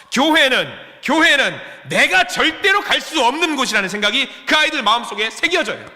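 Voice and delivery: loud, high impassioned preaching; fiery preaching. Acted. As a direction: drive the point home from the pulpit. Preaching at full force.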